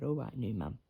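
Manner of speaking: low, soft little pigeon voice; the pigeon answering her new owner, low and soft; voicing the pigeon. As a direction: give the bird a small, low voice. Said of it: "she said".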